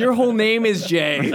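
loud, high, laughing tease. Real, not acted. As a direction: laugh through the joke. Laughing joke at host.

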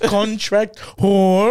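slow, drawn-out robotic delivery; robot-like accent. Shown as a demonstration. Comedian demonstrating his friend accent, slow robotic voice. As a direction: stretch it out like a stilted robot. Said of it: "like, a robot almost".

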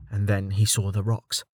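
usual speaking voice

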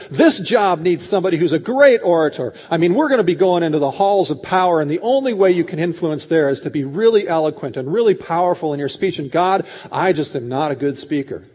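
acted, emphatic objection; protesting. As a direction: argue your way out of the job. Acted Moses arguing with God.

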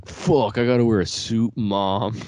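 put-on exasperated thirteen-year-old's protest; complaining kid. Thirteen-year-old to mom about a funeral suit, mock complaining.

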